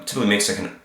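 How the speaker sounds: ordinary talk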